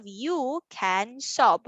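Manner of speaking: slow, separated words; word-by-word reading. Shown as a demonstration. Teacher reads the booklet sentence, word by word. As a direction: read it slowly, word after word. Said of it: "how about we read our sentence".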